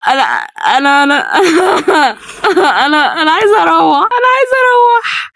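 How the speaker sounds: stammering, crying whine; tearful panic. Real, not acted. Scared, begging to go home.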